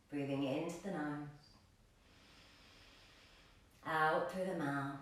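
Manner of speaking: soft, low meditation voice with long pauses; guided meditation. Acted. Yoga teacher guides class into grounding breaths, soft meditation voice. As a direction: settle them gently into the breath, hushed and unhurried.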